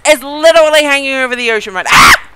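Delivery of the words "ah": sudden loud low shriek; real yelp of shock; a loud gut-level ah of fright; startled scream. Real, not acted. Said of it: "I don't know why I scream like that".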